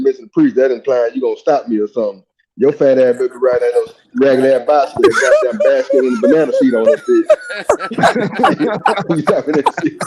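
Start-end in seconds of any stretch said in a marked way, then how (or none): 2.6-10.0 teasing through laughter; mock him while cracking up; laughing roast. Real, not acted.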